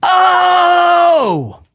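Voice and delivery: long, high held "oh" that drops at the end; drawn-out high cry. Retold or imitated. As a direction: sing out like an arena crowd. Joins in entrance song's crowd cry.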